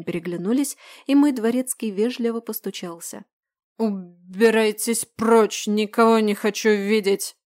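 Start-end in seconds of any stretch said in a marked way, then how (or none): 3.8-7.4 put-on slow, slurred drunken bark; drunken growl. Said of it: "Грозно рявкнули ... заплетающимся языком".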